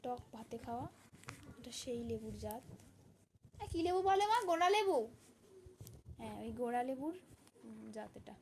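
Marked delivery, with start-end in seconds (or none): 3.6-5.0 high, loud call to another room. Real, not acted. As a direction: shout the question across the house. Calling out to mother.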